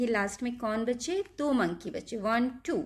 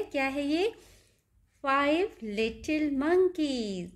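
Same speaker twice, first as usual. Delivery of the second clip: ordinary talk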